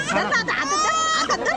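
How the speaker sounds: sudden high shout to hold her; calling out. Real, not acted. Calling to someone to hold baby.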